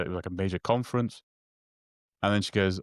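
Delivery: usual speaking voice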